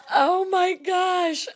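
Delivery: slow, wheezing laugh-cry. Real, not acted. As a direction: laugh till it breaks. Laughing about fire clip.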